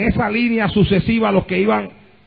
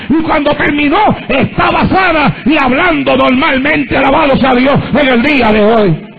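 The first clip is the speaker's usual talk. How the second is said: full-force preaching; 7 semitones higher than usual. Acted. Pastor testifying to his church at full force. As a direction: preach it out, driving each phrase.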